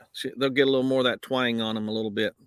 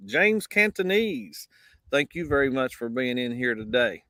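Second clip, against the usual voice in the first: ordinary talk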